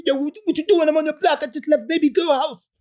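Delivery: high, loud imitation of a hyped friend on the phone; high-pitched impression of a homeboy. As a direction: pitch way up and call out like an excited buddy.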